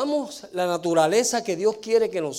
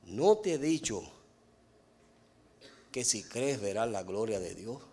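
soft, measured quote of Jesus; quiet, lower quoted reproach; Jesus addressing Marta; 8 semitones lower, 7 dB quieter than usual. Retold or imitated. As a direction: gently voice a reminder to Marta, softly and low. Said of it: "le dijo a Marta".

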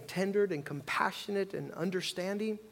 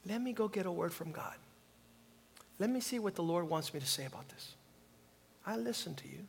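softer, unhurried inner voice; calm, soft reflection; 7 dB quieter than usual. Retold or imitated. Pastor models a patient reply, soft and calm. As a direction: quietly talk yourself into patience.

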